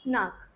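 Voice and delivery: "नाक" with a long आ; model pronunciation. Shown as a demonstration. Teacher answers how the German preposition is pronounced, model pronunciation. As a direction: say "नाक" as a lone model word, long open vowel. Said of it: "इसका क्या pronunciation रहेगा?".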